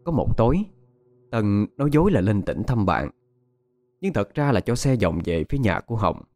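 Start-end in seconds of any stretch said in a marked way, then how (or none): none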